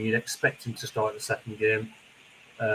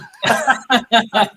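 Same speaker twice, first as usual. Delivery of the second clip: high laugh; 11 semitones higher, 8 dB louder than usual. Real, not acted. Laughs at cancelled subscription.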